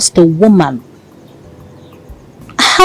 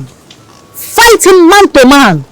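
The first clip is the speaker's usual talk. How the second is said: higher, slow, drawn-out challenge; mock rallying cry; 8 semitones higher, 3 dB louder, much slower than usual. Woman egging her lover to fight, slow and raised. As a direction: stretch it out to egg him on.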